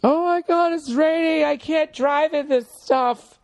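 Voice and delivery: high, slow mock panic over the rain; panicky LA driver. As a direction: whine like a scared Angeleno at a drizzle. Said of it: "Everybody always freaks out".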